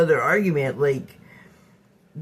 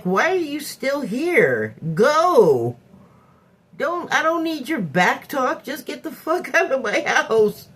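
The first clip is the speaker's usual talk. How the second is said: higher-pitched mock scolding, drawn out at first; retold exasperation; 6 semitones higher than usual. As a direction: re-enact fed-up orders to leave. Retold argument, ordering guest out.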